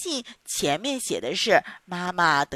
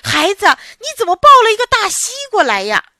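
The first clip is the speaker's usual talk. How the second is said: high, loud, fast startled cry; grandma screams at finding a watermelon instead of a baby; startled shriek; 10 semitones higher, 10 dB louder, faster than usual. Acted. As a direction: shriek in shock. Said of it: "吓得哇哇叫起来".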